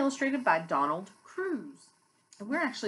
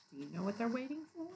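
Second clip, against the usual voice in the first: usual speaking voice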